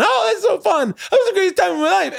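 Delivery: very high, squealing effeminate caricature; mock-gushing falsetto send-up of a giddy man; effeminate mocking impression. Worker mocking the comedian to his crew, effeminate impression. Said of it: "doing an impression of me going".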